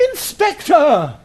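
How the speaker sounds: stretched, angry shout down the corridor; drawn-out shout. Acted. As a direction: yell it after him as he leaves. Calling after departing inspector.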